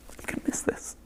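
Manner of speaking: whispered, voice giving out from tears; tearful whisper. Real, not acted. Creator saying goodbye to his channel, in a tearful whisper. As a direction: breathe it out, too choked to voice it. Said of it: "physically cry".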